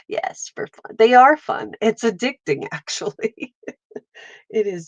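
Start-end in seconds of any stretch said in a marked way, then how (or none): 0.1-1.0 breathy whisper, to herself. Real, not acted. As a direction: whisper it like a secret. Whispered repeat.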